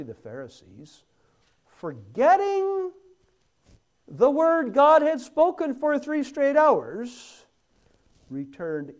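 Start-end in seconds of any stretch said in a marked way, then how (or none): 1.7-7.5 high, loud pulpit cry; impassioned preaching. Real, not acted. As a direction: cry out in disbelief at their forgetting. Preacher indignant at forgetting Pharisees.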